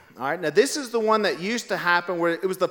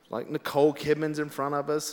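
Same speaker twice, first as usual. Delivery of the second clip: usual speaking voice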